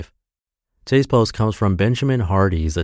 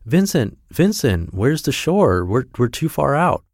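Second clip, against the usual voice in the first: higher, urgent call across the water; cries out; 6 semitones higher than usual. A narrator's reading. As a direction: call out to the brother, alarmed. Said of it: "Anton cries out".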